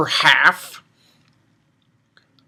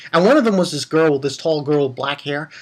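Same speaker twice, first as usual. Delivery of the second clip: ordinary talk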